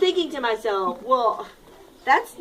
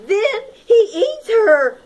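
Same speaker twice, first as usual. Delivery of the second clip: higher, louder, laughing; gleeful laughing; 9 semitones higher, 6 dB louder, slower than usual. Acted. Teacher as an ant gleefully ending the story, laughing. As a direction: blurt it out with a laugh.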